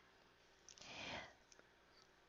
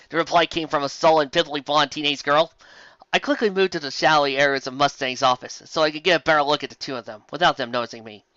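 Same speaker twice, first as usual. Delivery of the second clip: ordinary delivery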